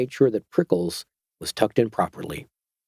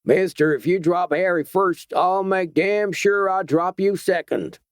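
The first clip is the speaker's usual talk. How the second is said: a hidden gunman backing up his friend; thicker voice; 8 semitones higher than usual. A narrator's reading. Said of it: "a thicker voice said".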